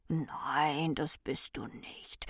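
very soft, slow, near whisper; tender comfort. A narrator's reading. Recht reassures the weeping Ordnung, tender and hushed. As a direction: soothe gently in a hushed voice.

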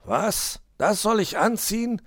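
high, rising outraged question; a fox balking at a costume; startled disbelief. A narrator's reading. Herr Fuchs baulks at the Santa hat, incredulous. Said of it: "Herr Fuchs stutzte".

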